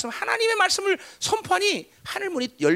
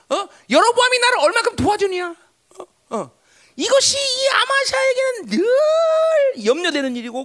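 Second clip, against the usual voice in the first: high, loud pulpit delivery; raised-voice sermon teaching; forceful preaching; 12 semitones higher, 6 dB louder than usual. Real, not acted. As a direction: preach it with urgent, punched questions.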